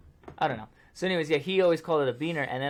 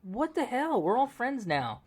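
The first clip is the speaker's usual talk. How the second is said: mock shocked; 9 semitones higher, 3 dB louder, slower than usual. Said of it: "And I'm like".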